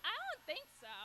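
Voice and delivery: high squeaky denial. Retold or imitated. To her sister at dinner, a squeaky high denial.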